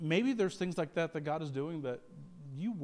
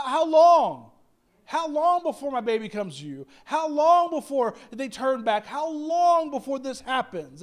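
louder, slow pleading how long; pleading prayer; 5 semitones higher, 7 dB louder than usual. Retold or imitated. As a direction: beg God with a raised voice. Mothers of church praying.

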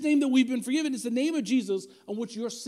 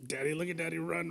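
mock kids' taunt; 6 semitones lower, 6 dB quieter than usual.

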